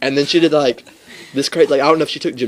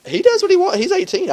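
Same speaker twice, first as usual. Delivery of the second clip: high retort. Retold or imitated. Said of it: "And I was like".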